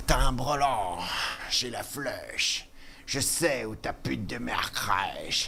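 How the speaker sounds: low, slow whispered rapper drawl; rapper impression. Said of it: "il est un peu facile à imiter".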